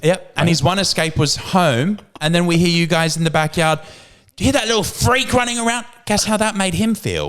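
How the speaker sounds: high mock-indignant parent; aggrieved gremlin parent. Gremlin parent accusing neighbours over their son, mock aggrieved.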